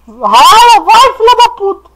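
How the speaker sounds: high terrified screaming; being chased. Real, not acted. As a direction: scream in real panic while fleeing. Monster chasing player in game.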